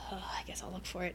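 fast whispered aside; whisper to self. Real, not acted. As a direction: whisper it under your breath. Muttering to herself.

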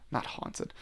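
breathy whispered self-correction; whispered aside. Real, not acted.

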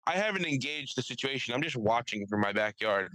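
usual speaking voice

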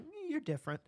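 high, soft, patronizing coo; mock condescension. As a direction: purr it like a backhanded compliment. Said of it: "someone just going".

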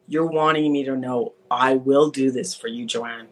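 normal talking voice

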